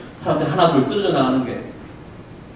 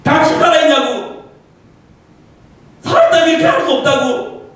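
raised, pained demand of God; anguished accusation; 11 semitones higher, 7 dB louder than usual. Retold or imitated. Preacher retelling his outburst at God, raised anguished accusation. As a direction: hurl the question at God in anger. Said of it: "그게 다 터져버렸어요".

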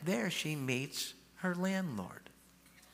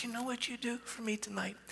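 unvoiced confiding whisper; hushed secret whisper; 8 semitones higher, 5 dB quieter than usual. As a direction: lean in and whisper it to her. Quiet aside to the woman.

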